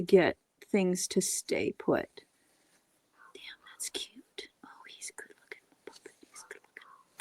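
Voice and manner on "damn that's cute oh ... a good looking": soft admiring whisper; hushed admiring whisper. Real, not acted. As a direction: whisper in quiet delight at the puppet.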